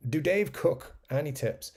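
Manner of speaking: ordinary delivery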